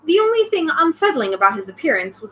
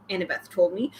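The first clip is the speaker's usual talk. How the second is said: ordinary delivery, nothing marked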